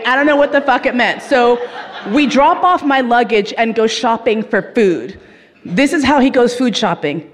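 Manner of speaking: usual speaking voice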